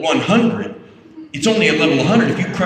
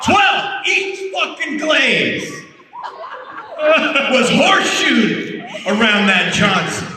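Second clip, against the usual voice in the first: laughing shout; 12 semitones higher, slower than usual. Real, not acted. Telling the host his naked Polaroid prank, shouting through laughter. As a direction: crack up while yelling it out.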